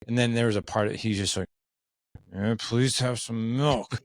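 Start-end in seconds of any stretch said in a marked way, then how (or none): 2.3-4.0 very slow, sighing, mopey "please have some milk"; reluctant son asking for milk; sighing kid. Retold or imitated. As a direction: drag it out with a deep sigh. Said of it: "without the deep sigh".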